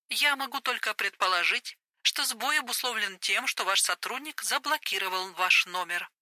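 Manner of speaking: calm, polite phone operator. Acted. Voiced female operator.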